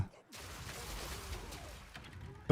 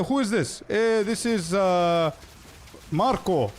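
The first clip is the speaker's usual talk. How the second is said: put-on Cypriot accent; 7 semitones higher, slower than usual. Police call with local Cypriot.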